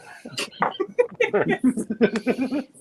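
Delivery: loud high laugh; high burst of laughter. Real, not acted. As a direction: break into laughter. Laughs at friend's reminder.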